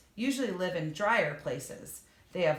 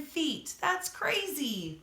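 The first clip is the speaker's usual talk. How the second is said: much higher, slow amazed exclaim; 7 semitones higher, much slower than usual. Real, not acted. Telling children how far frogs jump, amazed.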